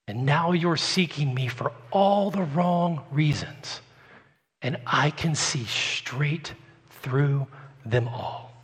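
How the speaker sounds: soft and slow, seeing straight through them; Jesus quietly exposes the crowd's motives; quiet, knowing Jesus. Acted. Preacher voicing Jesus to the crowd, soft and slow. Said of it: "But listen to Jesus".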